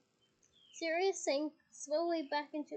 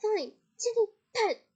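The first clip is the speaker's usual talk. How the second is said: louder, higher angry shout; 7 semitones higher, 11 dB louder than usual. Acted. Mother ordering her daughter out, loud shout. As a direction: snap it out, giving up. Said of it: "shouted Missus Weasley".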